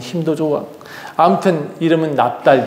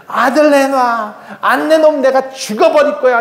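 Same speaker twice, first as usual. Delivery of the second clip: high, hammed-up wailing threat; desperate threat; 8 semitones higher than usual. Rachel threatens her husband over a son, high hysterical demand. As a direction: screech the demand like a tantrum. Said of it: "뭐라 그랬냐면".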